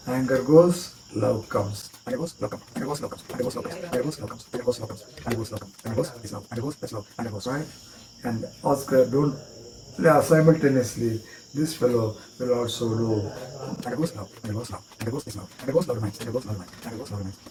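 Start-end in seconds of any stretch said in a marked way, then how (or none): 2.1-7.7 soft, very quick repeated chant; rapid patter. Shown as a demonstration. Magician repeating the line as each card goes, rapid patter. 13.8-17.4 soft, very quick repeated chant; rapid patter. Shown as a demonstration. Magician repeating the line as each card goes, rapid patter.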